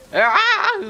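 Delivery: high, loud scream breaking into laughter; real high-pitched scream. Real, not acted. Player startled by a hard fight, screaming. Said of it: "Sorry for my screaming".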